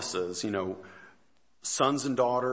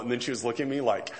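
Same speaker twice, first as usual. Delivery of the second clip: ordinary talk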